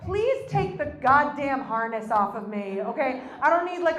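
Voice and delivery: ordinary talk, nothing marked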